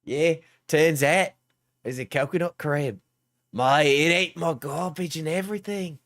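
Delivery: broad Queensland Aussie storytelling; Queensland accent. Pretend. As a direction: spin a yarn in a put-on Queensland accent. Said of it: "it's more of a Queensland accent".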